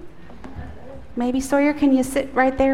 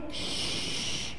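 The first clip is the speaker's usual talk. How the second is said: soft unvoiced shh; quiet shush; 15 dB quieter than usual. Shown as a demonstration. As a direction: hush to hear the angel. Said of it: "we have to be quiet".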